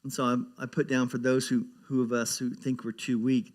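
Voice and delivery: ordinary talk, nothing marked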